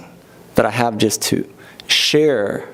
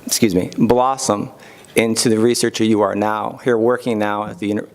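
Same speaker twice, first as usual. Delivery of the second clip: ordinary talk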